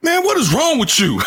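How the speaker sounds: high, exasperated scolding, laughing through it; the teacher has had enough; mock exasperated teacher. Teacher scolding kid online, hammed-up exasperation. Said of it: "teacher goes".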